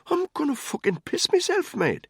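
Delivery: very high, strained-bladder plea; desperate pleading. A narrator's reading. Man begging controller for the toilet, desperate pleading. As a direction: beg as if about to wet yourself. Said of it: "gave him a painful look".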